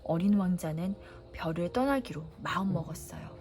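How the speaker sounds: usual speaking voice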